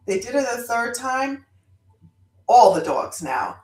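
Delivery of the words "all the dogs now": plain reading voice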